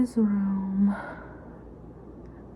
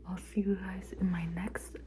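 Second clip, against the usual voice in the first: soft whispered goodbye; whispering; 9 dB quieter than usual. Real, not acted. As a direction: whisper it gently. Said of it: "I'm trying to be quiet".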